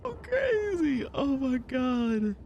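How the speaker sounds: high, slow wailing; drawn-out scared moan; mock frightened. Still reacting in fright.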